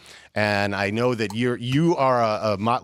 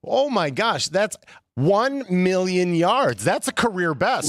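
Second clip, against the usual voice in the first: mock amazement; 6 semitones higher, slower than usual. Mock amazement reading stat.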